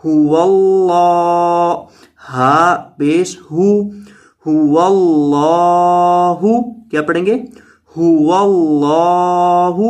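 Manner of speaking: هو اللہ with the lam of اللہ held long; drawn-out lam. Shown as a demonstration. Teacher showing the long lam to students. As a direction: stretch the اللہ in هو اللہ. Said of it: "لمبا کیا جائے گا".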